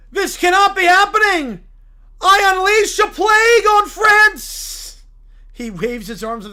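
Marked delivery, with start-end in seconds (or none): 0.1-5.0 loud, anguished preacher's cry; a wounded zealot shouting at the sky; outraged preacher. Acted. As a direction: howl it like your church just fell. Character curse.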